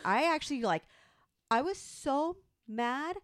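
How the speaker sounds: ordinary talk, nothing marked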